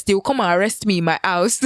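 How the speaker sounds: ordinary delivery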